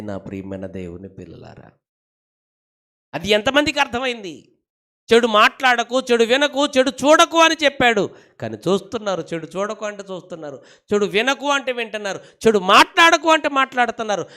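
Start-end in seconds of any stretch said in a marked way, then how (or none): 3.1-14.3 full-force preaching. Real, not acted. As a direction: belt it out to the congregation.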